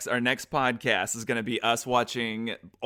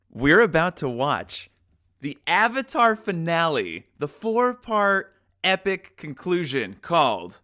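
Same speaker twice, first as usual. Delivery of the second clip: loud, slow, grand announcement; dramatic, drawn-out show intro; epic announcer; 4 semitones higher, 5 dB louder, slower than usual. Acted. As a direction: build it up like an epic reveal.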